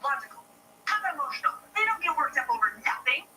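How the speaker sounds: escalating huffy complaint; worked-up outburst. Acted. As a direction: customer insisting on a man, escalating indignant rant. Customer getting worked up.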